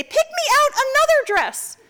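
put-on high demanding kid voice; mother voicing her little daughter, high and demanding; little girl voice. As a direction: ask like a small child.

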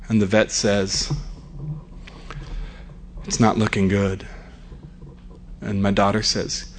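low, hushed, grave narration; solemn suspense storytelling. Acted.

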